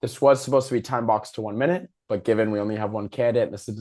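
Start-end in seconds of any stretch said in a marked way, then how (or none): none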